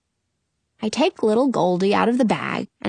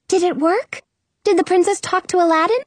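high, bright-eyed genie greeting; genie Karima; 8 semitones higher than usual. Acted. Genie greeting her friends, high and cheery.

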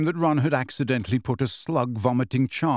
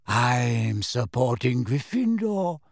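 slow, vague, dreamy voice; dreamy Luna; slower than usual. A narrator's reading. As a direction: drift through it, unhurried. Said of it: "a vague and dreamy voice".

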